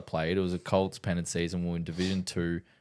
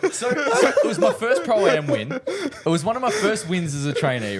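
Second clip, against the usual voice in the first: high, loud, through helpless laughter; laughing protest; 6 dB louder than usual. Real, not acted. Teased man defends his first Pro-Am win, laughing. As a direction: protest while cracking up.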